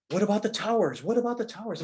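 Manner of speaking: high-pitched urgent questioning; imitated frantic wife. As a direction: play an alarmed wife on the phone. Acts wife's urgent question on phone.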